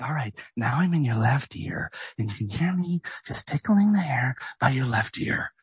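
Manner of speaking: whispered, mocking ASMR voice close to the ear. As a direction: parody a soothing ASMR whisper. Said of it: "where you do the A S M R".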